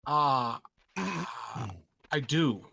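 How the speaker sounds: ordinary talk, nothing marked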